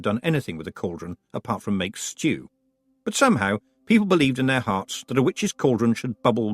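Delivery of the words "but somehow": usual speaking voice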